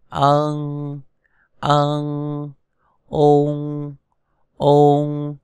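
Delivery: long, level, held syllables; sustained monotone syllables with pauses between; pronunciation drill. Shown as a demonstration. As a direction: chant each sound slowly for learners to repeat. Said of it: "Chúng ta cùng đọc lại một lần nữa".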